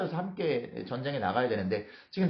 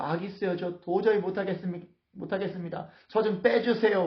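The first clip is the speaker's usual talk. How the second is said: higher-pitched mock begging; acted pleading; 7 semitones higher than usual. David asking Achish to excuse him, acted pleading. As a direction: plead to be let off, rising to the last request.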